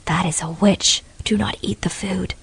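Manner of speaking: whispered warning. Acted. Fatima warning her friends about the witch, whispered. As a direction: hush it so the witch can't hear. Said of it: "said in a quiet voice".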